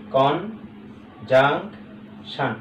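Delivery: "Conjunction" slowly, as কন, জাং, শান; syllable by syllable. Shown as a demonstration. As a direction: pause after each syllable of "conjunction". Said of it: "তাহলে কন একটা".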